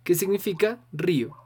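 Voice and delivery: usual speaking voice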